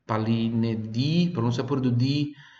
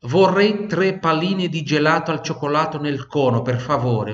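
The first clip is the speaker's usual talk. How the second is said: brighter, higher teacher model; model sentence, higher; 4 semitones higher, 3 dB louder than usual. Shown as a demonstration. Teacher sets up the sentence for students to repeat. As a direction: say it cleanly to be repeated.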